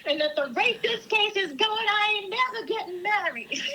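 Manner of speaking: put-on high-pitched New York movie accent; Marisa Tomei. Finishing co-host's movie quote, high mock New York accent. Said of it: "mental picture of Marissa Tomei".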